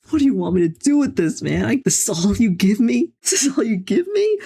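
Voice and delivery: high-pitched protest climbing to a squeak; incredulous complaint. Real, not acted. Player protesting the bare map he was given, incredulous.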